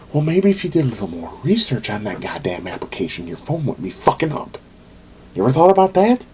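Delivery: rising into a furious yell; angry rant. Real, not acted. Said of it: "I'm venting".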